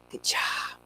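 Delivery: soft whispered good job; whispered praise. Real, not acted. Trainer praising the puppy, whispering. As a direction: praise her under your breath.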